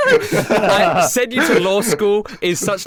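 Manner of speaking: high-pitched laughing through words; can't stop laughing mid-sentence. Real, not acted. Bursts out laughing.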